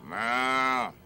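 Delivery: a mock cat meow for the cow; deadpan joke meow; silly meow. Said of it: "remember the cow goes".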